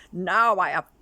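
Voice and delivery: Chameleon calling to Crocodile from the branch. Acted. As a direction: boast to Crocodile across the water.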